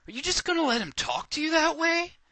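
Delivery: high, loud, outraged question; indignant challenge. A narrator's reading. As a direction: egg the child on, loud and very high. Indignant challenge acted in narrated story.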